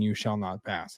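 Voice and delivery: a hint of a solemn old wizard; Gandalf. Host jokes to listeners about joining the group, light wizard impression. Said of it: "Little Gandalf for you".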